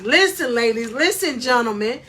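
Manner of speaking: ordinary talk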